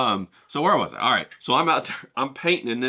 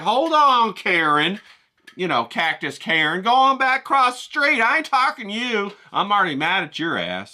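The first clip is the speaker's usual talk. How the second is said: higher, louder brush-off; mock telling off; 6 semitones higher, 5 dB louder than usual. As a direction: shoo her away. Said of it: "And I was like".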